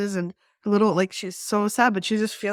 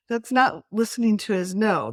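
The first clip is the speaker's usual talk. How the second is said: normal talking voice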